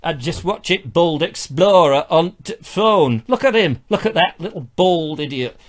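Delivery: mock gruff northerner's accent; North Country accent. Imagining Travis watching the show, hammed-up North Country accent. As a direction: grumble like a put-out North Country viewer. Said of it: "a North Country accent?".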